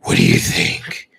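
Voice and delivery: deep gravelly whispered Batman; hoarse whispered vigilante. Pretend. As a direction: growl it low and breathy like a caped hero. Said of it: "the Batman voice".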